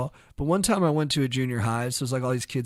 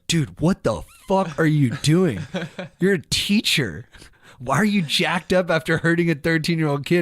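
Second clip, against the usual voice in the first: stern principal; 3 semitones higher, 5 dB louder than usual. Retold or imitated. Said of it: "not even, like, in a fire mood".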